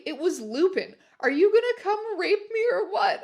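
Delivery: yelled. Acted. Heroine yelling at Lupin at the door, laughing. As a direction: yell it while barely holding the laugh in. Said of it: "I yelled".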